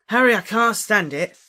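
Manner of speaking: high, loud desperate cry; desperate outburst. A narrator's reading. Lovesick Ron confesses to Harry, desperate outburst. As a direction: burst out as if it's unbearable.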